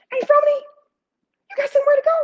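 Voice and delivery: high, put-on voice for the boyfriend; boyfriend's voice. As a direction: voice him up high and smooth. Boyfriend voiced in retold story.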